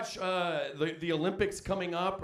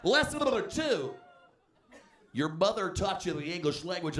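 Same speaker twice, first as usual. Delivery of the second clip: drill sergeant; 4 semitones higher than usual. Retold or imitated. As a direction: drill the class of recruits. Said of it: "like boot camp for your mouth".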